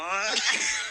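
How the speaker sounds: high squealing laugh at the fall; a sudden high yelp of a laugh; shrieking laugh. Real, not acted. Gamer squeals with laughter after falling. Falls while playing parkour.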